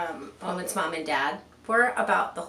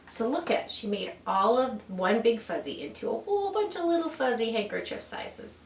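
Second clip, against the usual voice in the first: ordinary delivery, nothing marked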